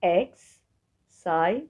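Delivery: "Excited" broken up with long pauses between syllables; split into syllables. Shown as a demonstration. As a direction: say "Excited" as X, sai, ted, slowly. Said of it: "أنت بتحفظ حرفين". (نطق "Excited" مقطعًا مقطعًا ببطء)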